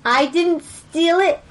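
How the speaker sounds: high, slow protest of innocence; indignant denial. Acted. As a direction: insist innocently, drawing it out. Player denies theft to pirate.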